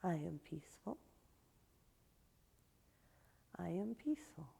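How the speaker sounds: very soft, fading toward a whisper; hushed affirmation. Shown as a demonstration. Yoga teacher leading students in focusing on their intention, hushed. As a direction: repeat it barely aloud, as if to yourself.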